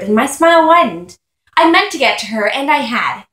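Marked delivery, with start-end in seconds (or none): none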